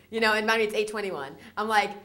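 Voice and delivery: normal talking voice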